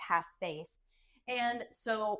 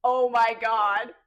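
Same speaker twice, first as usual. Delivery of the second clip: laughing exclamation; 12 dB louder, much slower than usual. Real, not acted. Recovering from laughing.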